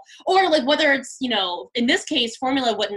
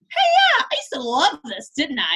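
put-on high, louder opening then settling kid voice; a hint of a chirpy child; imitating son; 6 semitones higher, 3 dB louder than usual. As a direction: nostalgic little boy, lightly voiced. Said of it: "he's like".

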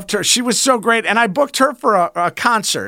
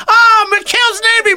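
excited recognition; 11 semitones higher, 5 dB louder than usual. Retold or imitated. Waiter recognizing him, retold.

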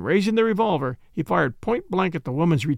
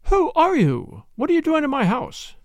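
much higher, indignant cry; alarmed demand; 10 semitones higher than usual. Acted. Woman confronting strangers in her house, alarmed demand. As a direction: challenge the intruders in a high voice.

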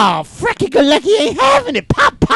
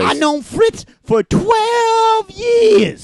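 shrill overlapping reality fight; 6 semitones higher, much slower than usual. Acted. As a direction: drag out every word in anger. Reality fight, slow shout.

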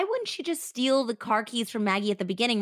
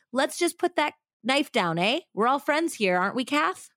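higher, light Australian cop coaxing; Australian accent; 6 semitones higher than usual. Acted. Police officer coaxing Catherine to drop the knife, in a light Australian accent. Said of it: "That's such a Aussie".

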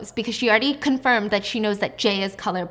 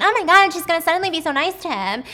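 higher and louder, giddy imagined gasp; mock excited thought; 8 semitones higher, 7 dB louder than usual. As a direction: play his smug inner voice, thrilled. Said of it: "he's probably thinking".

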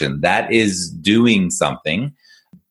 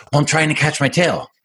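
high-pitched, eager dog voice; hammed-up excitement in a higher voice; excited dog; 8 semitones higher than usual. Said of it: "He's all excited".

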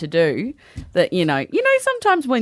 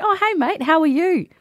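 high, bright friendly hello; upbeat small talk to a colleague; mock cheery greeting; chirpy greeting; 10 semitones higher than usual. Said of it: "And you go".

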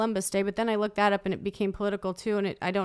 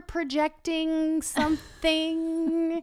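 slow, higher mock voice of husband; imitating husband; 6 semitones higher, much slower than usual. Wife mocking Jonathan's careful words, slow and higher. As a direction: drag it out like a sheepish therapist. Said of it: "He's like".

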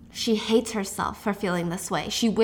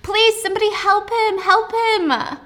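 much higher, louder desperate cry for help; panicked girl screaming for help; hysterical plea; 10 semitones higher, 4 dB louder than usual. A narrator's reading. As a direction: beg frantically for someone to help. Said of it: "she's screaming".

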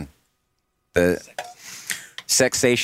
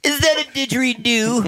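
much higher, laugh-filled joking question. Real, not acted. Joking about a humming noise while peeing, laughing. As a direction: ask it while cracking up.

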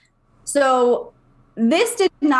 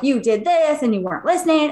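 a lightly voiced child scolding, higher; child lecturing; 4 semitones higher than usual. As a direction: scold like a little mother, in a higher voice. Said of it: "and, like, lecturing her".

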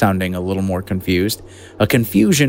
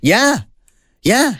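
high, eager yeah yeah; excited yeah; 12 semitones higher than usual. Real, not acted. Cohost asks if it's based on art, eager high yes. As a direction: squeal it with delight.

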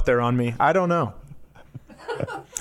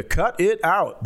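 catchphrase impression; 6 semitones higher, slower than usual. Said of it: "He's doing, like".

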